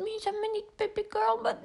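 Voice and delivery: imitating a needy guy calling her baby girl; clingy guy's message. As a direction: tease him by mimicking his sugary text. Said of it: "että oh my god".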